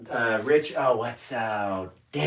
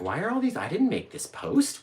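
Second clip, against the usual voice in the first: mock bafflement; 7 semitones higher, 5 dB quieter than usual. Quoting himself puzzled by posts.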